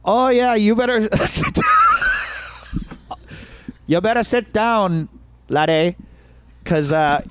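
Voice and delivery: high-pitched, hammed-up Irish accent; badly faked Irish lilt, drawing out the punchline; botched Irish accent. Club owner warning the next comic, botched Irish accent. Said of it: "I'm gonna fuck up this Irish accent".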